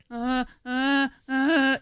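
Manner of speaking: high mock whimper of a scared kid; scared kid noises. Mother imitating her scared son, high mock whimper. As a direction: imitate a frightened child stammering. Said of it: "they're going like".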